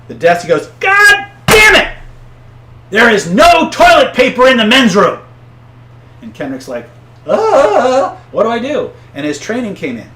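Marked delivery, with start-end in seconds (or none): 0.8-5.2 furious roaring complaint, very high and loud; slow, high, explosive yell about the toilet paper; raging shout. Retold or imitated. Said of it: "he's raging". 7.3-8.9 high, loud startled yelp; flustered cry of a cornered desk clerk; panicked. Retold or imitated. Clerk panics at raging customer.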